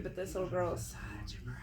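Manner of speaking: whispered confession about a brat. Real, not acted. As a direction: confide it under your breath. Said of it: "whispered aside".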